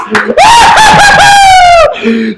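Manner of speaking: high, loud shrieking laugh of joy. Real, not acted. Fan cracking up with joy after the goal, shrieking laugh. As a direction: cackle it out at the top of your voice.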